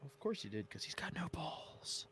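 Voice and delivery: hushed mocking whisper; stage whisper. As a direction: whisper it like a conspiratorial jab.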